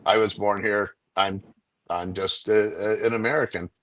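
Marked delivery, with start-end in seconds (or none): none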